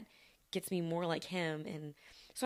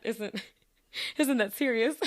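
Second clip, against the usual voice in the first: higher, louder, breaking into a laugh; 7 semitones higher, 4 dB louder than usual. Real, not acted. Podcaster sharing a funny note with listeners, laughing. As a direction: say it through a laugh. Cracks up over her own note.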